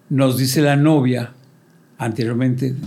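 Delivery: normal talking voice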